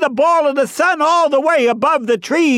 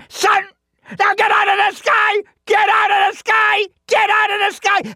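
high, frantic screamed commands; screaming bear; 8 semitones higher than usual. A narrator's reading. Bear screams at the sun in the sky, enraged. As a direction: scream it over and over in rage. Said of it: "started to scream".

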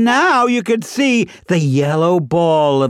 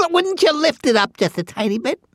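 high, quick squeaky plea; wheedling chipmunk; 11 semitones higher, much faster than usual. Acted. Chipmunk asks Bear to lift his paw, squeaky wheedling. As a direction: coax in a tiny squeezed voice.

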